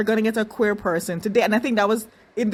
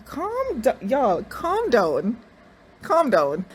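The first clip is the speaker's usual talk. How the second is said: very slow, drawn-out calm down; 3 semitones higher, much slower than usual. Retold or imitated. Telling the fawning cast to calm down, very slowly. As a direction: stretch every word to settle them.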